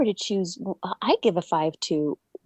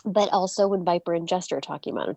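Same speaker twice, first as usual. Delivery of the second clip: ordinary talk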